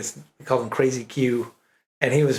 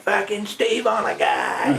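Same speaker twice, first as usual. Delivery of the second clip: mock yell; 6 semitones higher, slower than usual. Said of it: "they'd be like".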